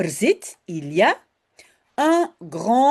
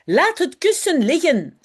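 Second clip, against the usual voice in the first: stern mother; 6 semitones higher, 4 dB louder than usual. A narrator's reading. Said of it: "zegt moeder".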